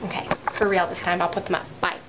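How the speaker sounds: ordinary talk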